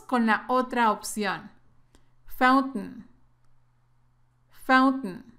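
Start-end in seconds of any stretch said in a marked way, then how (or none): 2.3-5.3 "fountain" as "fount'n", glottal stop and no final vowel; "fountain" with a glottal t, final syllable swallowed. Shown as a demonstration. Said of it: "como que si te tragaras esta última sílaba".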